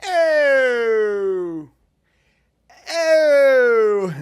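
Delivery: long, high-pitched "ohh"; high drawn-out "oh". Shown as a demonstration. Host winds up for a Boston accent with high "ohs". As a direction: wind up with a high, sustained "oh". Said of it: "Can you do a Boston accent?".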